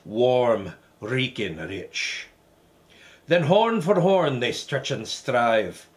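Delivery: put-on Scottish recitation voice; Scottish accent. Reciting Burns's Address to a Haggis, theatrical Scottish accent. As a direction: declaim it in a movie-learned Scottish accent. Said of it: "do the whole thing in the Scottish accent".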